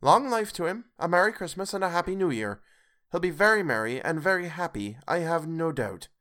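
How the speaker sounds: wife mocking Scrooge's happiness; sarcastic toast. A narrator's reading. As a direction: toast him with heavy sarcasm. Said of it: "said Missus Cratchit".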